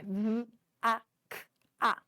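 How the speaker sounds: "V, A, K, A" sounded out one phoneme at a time; sounding out phonemes. Shown as a demonstration. Teacher breaking vaca into its four sounds, one by one. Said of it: "vaca tem quatro sons".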